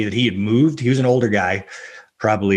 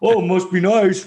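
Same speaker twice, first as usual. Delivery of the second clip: slow, higher, sarcastic jab; sarcastic actors; 8 semitones higher, slower than usual. Retold or imitated. Real actors mocking his luck, sarcastic. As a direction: drawl it out like a bitter actor. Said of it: "actual real actors that are like".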